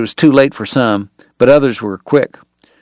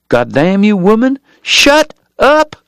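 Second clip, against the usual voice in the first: furious shout; 10 semitones higher, slower than usual. Acted. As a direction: lose his temper at her. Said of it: "nothing left for courtesy".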